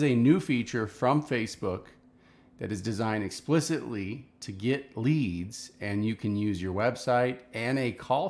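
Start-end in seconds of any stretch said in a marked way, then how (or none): none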